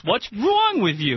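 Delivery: slow New Jersey "What's wrong with you?" repeated; Jersey accent again. Pretend. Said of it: "I said that with my Jersey accent very much on purpose".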